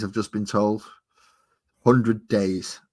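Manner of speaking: ordinary delivery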